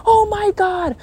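high, alarmed exclamation; mock scared cry. Storyteller reliving the sudden shocks, high mock-frightened cry. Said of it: "I got scared".

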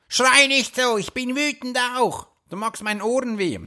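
high-pitched imitation of an annoyed parent; mock scolding parent. Parent scolding a screaming child.

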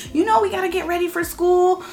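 high, hurried school-morning nagging; mock harried parent. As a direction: rush the kid out the door. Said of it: "or whatever it is".

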